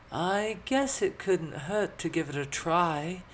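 high, slow, reluctant agreement; doubtful. A narrator's reading. Elizabeth agreeing to attempt magic, doubtful high voice. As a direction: agree while clearly unconvinced. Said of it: "look on her face that suggested otherwise".

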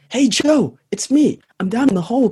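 light up on seeing Joe; relieved call to friend. Acted. Man stuck in the hole spots his friend Joe, calls up eagerly.

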